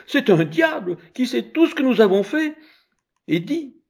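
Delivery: high, loud horrified cry; frightened women. Acted. Women horrified by the child, high frightened cry. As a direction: gasp out that he is a devil. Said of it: "femmes stupéfaites".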